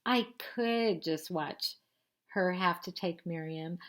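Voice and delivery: plain reading voice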